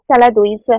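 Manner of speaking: usual speaking voice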